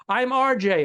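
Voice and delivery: mock cheerful shuttle driver; cheery driver. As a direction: chirp your name like an eager driver. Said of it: "He goes".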